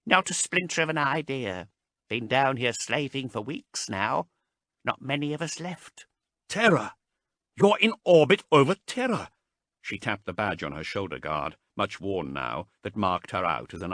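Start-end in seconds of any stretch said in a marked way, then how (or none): 0.0-6.1 put-on a lightly raised, thin old voice; Marast's frail voice. A narrator's reading. Marast explaining their slavery to Haas, thin voice. 6.5-9.3 high, loud urgent announcement; excited exclamation. A narrator's reading. Haas telling the abhumans they orbit Terra, loud and high.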